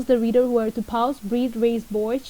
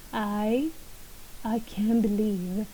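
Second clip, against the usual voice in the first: halting, unsure, with long pauses; trailing pauses; 3 dB quieter, slower than usual. Shown as a demonstration. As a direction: leave each phrase hanging in disbelief. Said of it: "ellipses, drift off".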